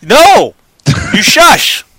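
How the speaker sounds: high, loud playful shush; mock outburst. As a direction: shut the cohost up in mock anger. Cutting off cohost teasingly.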